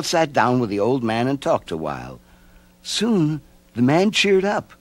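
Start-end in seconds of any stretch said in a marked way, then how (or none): none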